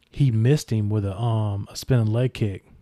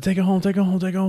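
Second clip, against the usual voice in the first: yelling; 8 semitones higher, faster than usual. Retold or imitated. Injured wrestler yelling to finish the match, high mock yell. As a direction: urge the partner to end the match now. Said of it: "I just started yelling".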